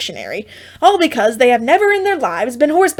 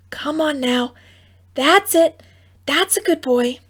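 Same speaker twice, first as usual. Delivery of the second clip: coaxing; 5 semitones higher than usual. A narrator's reading. Coaxing the foal.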